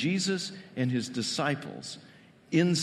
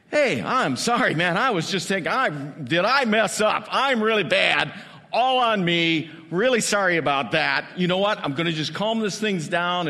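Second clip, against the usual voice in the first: louder, fawning over-apologetic voice; mock apologetic Jesus; 4 semitones higher, 7 dB louder than usual. Hypothetical Jesus apologizing to the disciples, mock fawning. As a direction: grovel and smooth it over, hammed-up.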